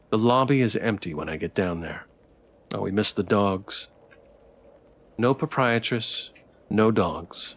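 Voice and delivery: ordinary talk, nothing marked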